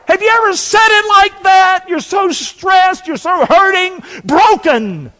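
high, loud preaching at full force; impassioned shouting. Real, not acted. Preacher asks stressed, hurting people if they relate, impassioned shouting. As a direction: cry it out with stretched key words.

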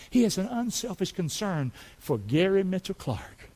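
quiet, intimate testimony; soft. Real, not acted. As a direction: bring it down close and personal. Preacher softens to a personal aside.

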